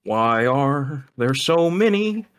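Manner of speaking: high, slow Muppet voice. Pretend. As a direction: drawl it like a puppet. Continues the Muppet joke.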